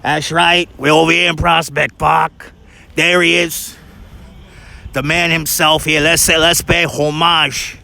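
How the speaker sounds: put-on thick Brooklyn accent; old Brooklynese accent. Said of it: "you learn old Brooklynese".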